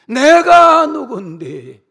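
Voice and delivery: slow, loud, high indignant shout; mock boss shouting who do you think I am; arrogant boast. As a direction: blast it like an offended boss. Mocking arrogant CEOs.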